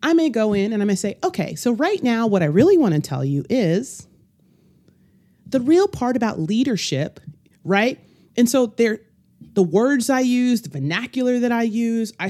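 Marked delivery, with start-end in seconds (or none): 1.2-7.2 code-switched corporate voice. Shown as a demonstration. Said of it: "But when people code switch".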